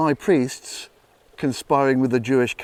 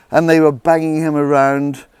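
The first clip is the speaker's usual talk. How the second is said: ordinary delivery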